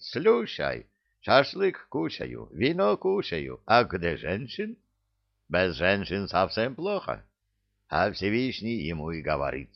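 put-on animated Caucasian-accented joke telling; Givi, comic storyteller. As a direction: spin a tall tale with an accent. (Гиви травит анекдот с кавказским акцентом)

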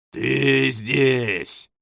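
thundering demon voice; booming voice. A narrator's reading. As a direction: boom it out like thunder. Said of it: "прогремел голос".

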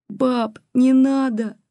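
high-pitched pleading squeal; girlish squeak. A narrator's reading. Granddaughter begging granny to stop, squeaky plea. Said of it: "пискнула Катерина".

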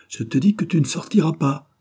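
high, forceful protest; insistent forbidding. A narrator's reading. Old wife clinging to husband's arm, insisting shrilly high. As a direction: desperately forbid him to go. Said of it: "répétait la vieille, qui se cramponnait".

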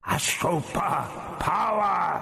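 slow, booming, echoing correction; correcting alien voice. A narrator's reading. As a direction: correct him grandly as a hollow alien ruler. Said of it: "the voice corrected him".

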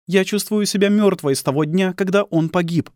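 high-pitched, bitter woman's voice; bereaved bitter wife. Acted. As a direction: voice the embittered wife, high throughout.

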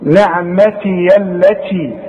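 نعمتي التي joined, the yaa opened and carried on into التي; نعمتي التي linked smoothly, slowly. Shown as a demonstration. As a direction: recite it slowly and run the open yaa into التي. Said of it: "إذا وصلت الياء مفتوحة".

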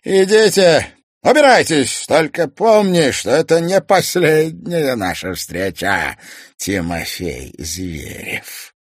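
slow, loud looming villain; slow theatrical villain menace. Acted. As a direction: threaten slowly with relish. Toymaster menaces.

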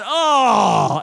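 fan's disappointed sigh at the preacher, mock guttural groan. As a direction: let out a disgusted guttural oh. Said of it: "She did a guttural sigh".

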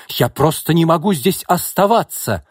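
high, loud indignant outburst; crying out. A narrator's reading. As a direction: shout that you've had enough. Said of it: "выкрикнул Яков". (возмущённо выкрикнуть)

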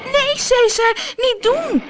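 high, loud, desperate cry; frightened scream. A narrator's reading. Floortje screaming at Cesar to let Keesje go, high and frightened. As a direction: shriek at the lion to stop. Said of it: "schreeuwde Floortje".

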